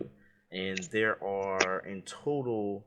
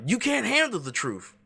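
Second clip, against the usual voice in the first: 1 furious shout; 12 semitones higher, 5 dB louder than usual. Acted. Quoting A Few Good Men.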